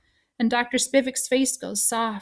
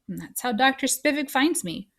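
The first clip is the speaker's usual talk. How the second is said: plain reading voice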